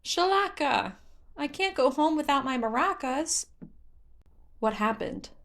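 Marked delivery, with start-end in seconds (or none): 0.0-3.5 high, whiny little forest spirit; a child on the verge of a tantrum; upset Korok. Acted. Hestu upset over lost maracas.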